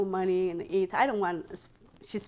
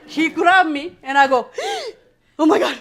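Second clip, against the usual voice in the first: alarmed telling; 6 semitones higher, 12 dB louder than usual. Retold or imitated. Grabbed, alarmed.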